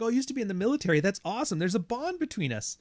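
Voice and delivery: high, loud mocking gush of a fake veteran; mock eager poser. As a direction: gush like a wannabe soldier.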